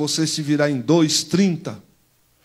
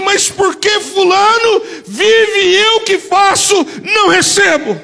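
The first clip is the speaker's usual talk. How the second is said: crying out; 6 dB louder, slower than usual. Real, not acted. Anguished question cried out.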